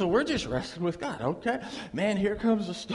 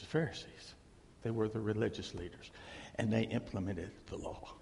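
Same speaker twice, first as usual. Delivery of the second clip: hushed intensity; 9 semitones lower, 9 dB quieter than usual. Real, not acted. Sermon drops to hushed intensity.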